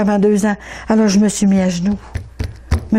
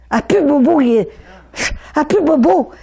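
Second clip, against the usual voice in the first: joie émue; 6 semitones higher than usual. Real, not acted. Guérison miraculeuse racontée.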